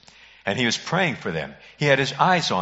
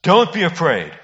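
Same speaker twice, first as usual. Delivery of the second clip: higher and louder, commanding and reassuring; Jesus calling out; 5 semitones higher, 7 dB louder than usual. Retold or imitated. Jesus to the disciples in the storm, loud reassuring call. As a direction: call across the storm to frightened men. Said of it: "He said".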